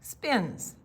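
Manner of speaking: usual speaking voice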